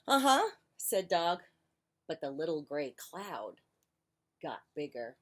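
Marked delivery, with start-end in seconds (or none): 0.1-0.5 dog's high, loud uh-huh. Acted. Dog brushing Duck off, high and loud. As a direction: squeak it up as the dog.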